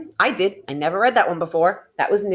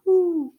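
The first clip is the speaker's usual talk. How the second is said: owl hoot; 10 semitones higher than usual. Pretend. Owl in story, hooting.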